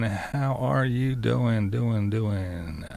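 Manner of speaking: stretched-out words, falling away in pitch; drawn-out greeting. Real, not acted. Host greeting viewers on Thirsty Thursday, drawn-out and fading. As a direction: draw out "doing" like a fading echo.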